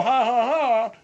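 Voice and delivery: high, jeering mock ha-ha; imitated mocking laugh. General calling Bud a liar, mocking imitation. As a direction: throw his fake laugh back at him. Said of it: "come out like a song".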